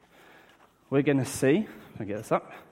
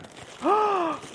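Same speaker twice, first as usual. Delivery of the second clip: excited gasp. Pretend. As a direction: gasp as if delighted by a present. Pretends surprise at opening present.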